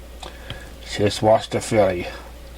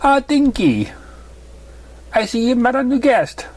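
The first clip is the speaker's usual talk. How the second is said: higher, gentle mother's voice; motherly mare voice; 12 semitones higher than usual. Acted. Mother greeting her daughter's new guest, higher voice. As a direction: play the kind mother pony.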